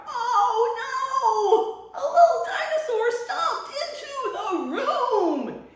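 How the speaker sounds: high, loud alarmed storytelling; excited read-aloud. Shown as a demonstration. Librarian reads the dinosaur's arrival to preschoolers, high and loud. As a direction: gasp it out like big news.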